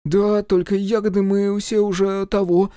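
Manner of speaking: высокий смущённый детский голос; мальчик виновато признаётся. A narrator's reading. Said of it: "потупился Захарка". (высокий голос смущённого мальчишки)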